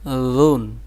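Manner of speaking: লুন, gently released at the start; soft consonant. Shown as a demonstration. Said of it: "এটা নরম". (লুন নরম করে)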